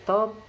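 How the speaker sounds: "Tom" called high and loud; direct-address intonation. Shown as a demonstration. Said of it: "It is used in direct address".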